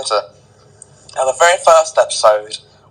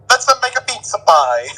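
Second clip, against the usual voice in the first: higher pitched hammed-up Italian chef accent; 9 semitones higher than usual. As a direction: play a cheesy Italian pizza chef. Italian chef act, pizza, moustache.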